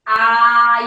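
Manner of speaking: a sharp loud "ah" sound demo. Shown as a demonstration. Teacher showing students a loud "ah". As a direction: belt out "ah" for the class. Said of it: "a gente faz".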